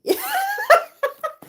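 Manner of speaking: high, loud burst of laughing; real laughter. Real, not acted. Reader laughing at what he may say, loud cackle. Laughs at own risqué quote.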